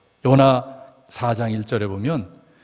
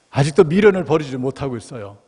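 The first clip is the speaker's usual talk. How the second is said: usual speaking voice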